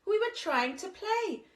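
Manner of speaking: put-on a child explaining in a rush; Danny's voice. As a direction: pitch up as young Danny. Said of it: "said Danny to Dinos A".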